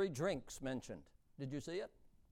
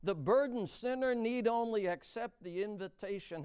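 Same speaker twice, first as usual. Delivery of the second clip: ordinary delivery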